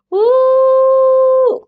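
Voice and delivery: high, loud whooping yelp; startled cry. A narrator's reading. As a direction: yelp as you're kicked into the air. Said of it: "cried old Tom".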